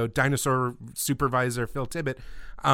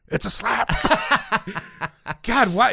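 dazed Ackbar quote; 8 semitones higher than usual. Retold or imitated. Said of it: "just go".

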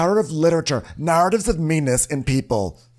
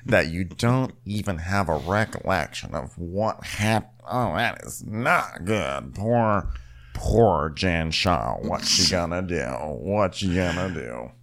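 low, soft, slow pitying; mock sympathy, low and slow; 8 semitones lower, 5 dB quieter than usual. Mock Meredith pity.

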